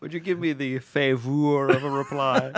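"favor" with an exaggerated U; mock British "favor". Co-host mocking British spelling, sounding a U in "favor". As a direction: say "favor" as fay-VOOR. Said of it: "you're mocking him and the Brits".

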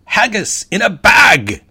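high, loud, slow Scottish cry of triumph; hammed-up Scottish eureka shout; Scottish accent. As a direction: trumpet it like a proud Scotsman. CEO's triumphant exclamation.

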